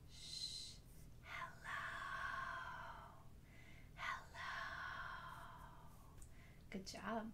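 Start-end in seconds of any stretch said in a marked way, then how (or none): 0.1-0.7 soft, drawn-out shush; hushing shh. Shown as a demonstration. Hushing before quiet hello. 1.2-4.4 tiny whispered greeting; as quiet as possible. Shown as a demonstration. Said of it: "as quiet as we can".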